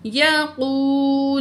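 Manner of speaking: یقو with the vowel stretched two counts; elongated yaqū. Shown as a demonstration. Teacher modelling the long vowel in یقو. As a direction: draw out the final وو of یقو. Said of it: "ٹو point کھینچ کے پڑھیں گے".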